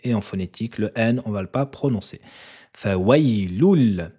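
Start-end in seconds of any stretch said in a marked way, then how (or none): 2.7-4.1 loul with the lam held, louder; teacher stressing the doubled lam for students. Shown as a demonstration. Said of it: "elle est dédoublée".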